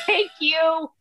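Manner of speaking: high, tight, fake-polite thank you; forced thanks. Frazzled mom thanking another parent, high and strained. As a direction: say thanks through gritted teeth. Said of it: "I was like".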